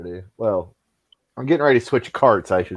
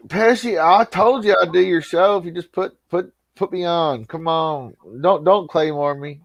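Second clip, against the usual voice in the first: higher-pitched pleading impression; Jake Hudson; 5 semitones higher than usual. Asking Pesci to put him on, in a put-on higher voice. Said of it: "I can do the Jake Hudson".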